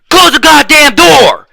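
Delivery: high, loud mock scream of an order. Vice principal ordering the door shut, screaming. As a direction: imitate her screaming at full blast. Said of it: "hear her ass fucking screaming".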